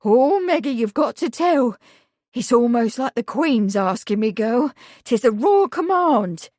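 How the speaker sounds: loud, eager pleading; excited urging. A narrator's reading. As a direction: press her on with excitement. Housekeeper urges Meg excitedly.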